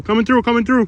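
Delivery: loud high call ahead; coming through shout. Real, not acted. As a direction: shout a warning into the woods. Announcing himself to hidden campers.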